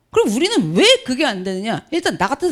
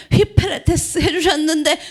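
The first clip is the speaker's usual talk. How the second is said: ordinary talk, nothing marked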